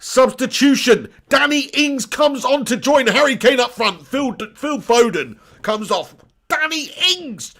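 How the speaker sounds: hammed-up TV commentary voice; mock commentator. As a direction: boom it out like a stadium announcer. Mock commentator announces the sub.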